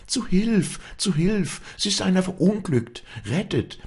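very high, urgent calling; trumpeting alarm cry. A narrator's reading. Walli wakes the village for help, crying out very high. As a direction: raise the alarm, pitched to carry. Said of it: "mit Posaunenstimme schrie sie die Schläfer heraus".

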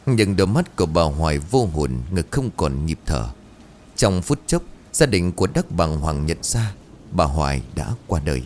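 ordinary delivery

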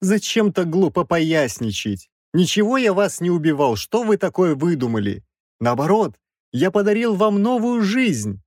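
high, whiny self-justification; protesting professor. A narrator's reading. Professor whines in self-defence.

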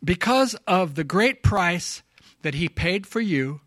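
normal talking voice

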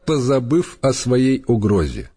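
plain reading voice

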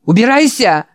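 put-on high shrill shriek of an old man. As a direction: scream it out, get out. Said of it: "завизжал старик". (визжа, прогоняет)

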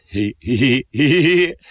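gloating heh-heh chuckle; a smug little laugh at the catch; soldiers chuckling. Acted. Soldiers gloating over the captive, chuckling. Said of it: "乐了".

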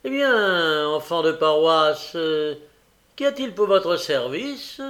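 mocking jovial. A narrator's reading. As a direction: boom it out with mock courtesy. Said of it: "prenant fort à propos un ton goguenard". (ton goguenard, fort et moqueur)

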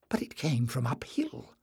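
soft, eager half-whisper; excited whisper. A narrator's reading. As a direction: breathe it out with eager discovery. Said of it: "whispered Jasmine excitedly".

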